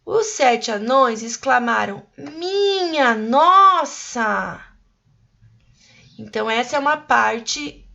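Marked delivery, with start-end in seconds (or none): 2.2-4.6 slow, drawn-out cry of amazement; amazed exclamation. Shown as a demonstration. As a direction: stretch "Minha nossa!" out in shock. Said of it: "a gente precisa fazer uma voz de espanto". (exclamação de espanto)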